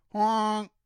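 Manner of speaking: loud, high honk like an air horn; playful loud honk for kids. Acted. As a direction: blast it out like a truck horn. Air horns shout in story.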